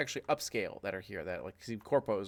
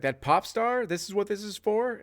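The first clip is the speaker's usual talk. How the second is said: higher, louder disbelieving outburst; can't believe it's about a pop star; incredulous fighter; 10 semitones higher, 5 dB louder than usual. Acted. Fighter hearing about [name], higher incredulous outburst. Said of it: "He's like".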